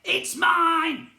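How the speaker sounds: high, loud, greedy goblin shout; greedy goblin claim. A narrator's reading. Goblin prince claiming the sea, high greedy shout. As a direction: claim it like a spoilt child.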